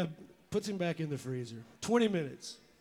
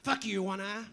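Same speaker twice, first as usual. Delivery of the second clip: parrot voice; 4 semitones higher than usual. Acted. Parrot insults the owner.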